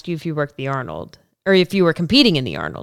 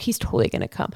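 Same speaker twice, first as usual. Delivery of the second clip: reassuring whisper; 6 semitones lower, 5 dB quieter, faster than usual. Retold or imitated. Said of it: "she was like".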